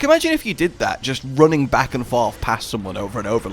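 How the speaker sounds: usual speaking voice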